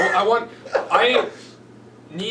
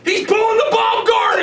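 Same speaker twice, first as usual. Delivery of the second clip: wrestling announcer; 7 dB louder than usual. Pretend. Mock wrestling announcer call.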